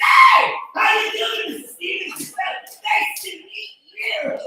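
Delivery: very high, strained, loud hello; frozen-faced greeting. Retold or imitated. Boyfriend mimics the mother's face while greeting her, strained squeal. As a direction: force politeness through a frozen face, pitched high. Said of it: "I just freeze with my face like that".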